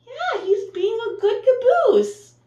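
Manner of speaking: high, loud cheerful praise; excited praise. Real, not acted. Teacher cheering the changed bus to her class, high loud praise. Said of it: "praising the bus to kids".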